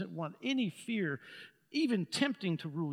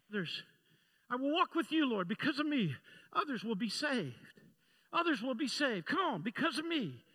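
higher, fervent declaration led line by line; leading a declaration; 7 semitones higher, 4 dB louder than usual. Acted. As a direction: call it out for the church to echo. Leads congregation in declaration.

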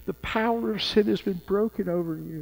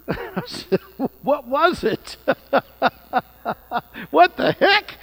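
high, laughing, disbelieving; laughing retelling; 9 semitones higher, 5 dB louder, slower than usual. Real, not acted. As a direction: laugh through the words.

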